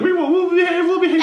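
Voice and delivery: high, whiny imitated sob-pleading; mock crying girls. Little girl cousins begging grandma not to go, mock crying high. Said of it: "they started crying".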